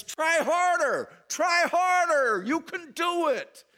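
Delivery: high, loud cheering from the stands; mock fan yell. Preacher mocking a God yelling from the stands, high shout. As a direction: bellow it like a fan in the bleachers. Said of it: "yelling from the stands".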